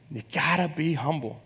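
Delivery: preacher hushing his point for weight. Shown as a demonstration. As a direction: let the last repeat sink in quietly. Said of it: "Number one, you gotta be humble".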